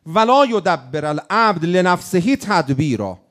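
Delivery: slow, weighty Arabic recitation; recited like scripture. Acted. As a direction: intone the Arabic line slowly. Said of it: "نکتهٔ دوم در علم".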